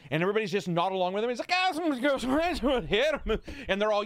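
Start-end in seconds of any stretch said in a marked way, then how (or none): 1.5-3.4 high, garbled mock Scottish accent; exaggerated, slow Scottish gibberish; unintelligible Scottish accent. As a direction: imitate a thick Scottish slur nobody can follow. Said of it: "I did not understand a single word".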